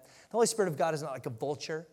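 ordinary talk

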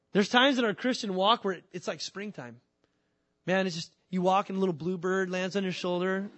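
usual speaking voice

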